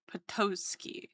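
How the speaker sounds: Potowski whispered, unvoiced. Shown as a demonstration. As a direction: breathe out Potowski. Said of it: "accentuate that it's a tos".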